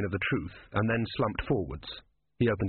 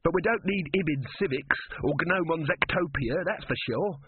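lightly voiced higher-pitched brisk sorter; practical young inventor; 7 semitones higher than usual. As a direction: dismiss the books in a higher voice. Inventor sorting books.

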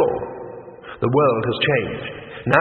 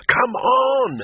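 hissing; 11 semitones higher, 4 dB louder than usual. Acted. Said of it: "he hissed".

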